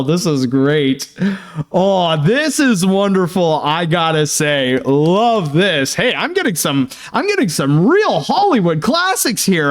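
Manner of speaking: usual speaking voice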